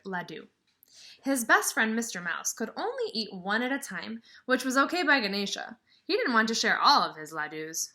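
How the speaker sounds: lively storybook reading; reading to children. Acted. Teacher reading a picture book to her class, lively storybook voice. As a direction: read it out to the kids with some sparkle.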